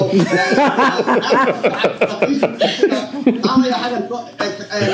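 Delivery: laughing. Real, not acted. Laughing at own bit.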